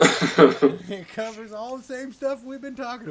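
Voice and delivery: loud laugh through the words. Real, not acted. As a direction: laugh out the line, high and loud. Laughing while recommending the book.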